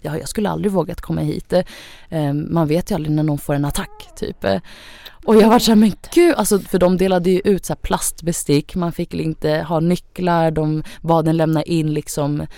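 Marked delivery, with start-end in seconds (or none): 5.8-6.3 high, loud startled "Men gud"; shocked gasp. Retold or imitated. At the shelter, realising it's serious, a loud startled outburst. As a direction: blurt it out in alarm.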